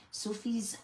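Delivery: playfully putting on airs; posh voice. Acted. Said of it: "Why did I say that in a posh voice?".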